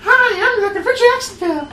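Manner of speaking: very high, loud squeezed stranger voice; strained high NPC voice. Acted. Game master trying out the stranger's voice, strained high falsetto. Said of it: "Can't do that voice".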